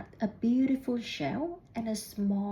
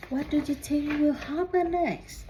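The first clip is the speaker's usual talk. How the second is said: eager question; 5 semitones higher than usual. A narrator's reading. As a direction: invite the children to guess. Asks listening children.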